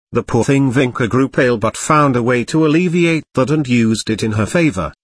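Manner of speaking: usual speaking voice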